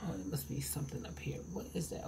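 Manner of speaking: hushed whisper to herself. Real, not acted. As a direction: whisper it, puzzled. Looking at something overhead.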